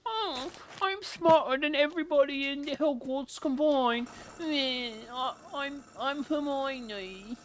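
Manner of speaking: mock Hermione as a smug show-off; snobby Hermione impression. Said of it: "she was so snobby".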